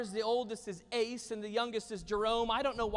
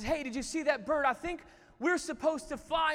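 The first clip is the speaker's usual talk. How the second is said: put-on higher, louder, keen young eagle; eager suggestion; 7 semitones higher, 5 dB louder than usual. Eagle tells his brother about a bird, eagerly. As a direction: blurt a new idea to your brother.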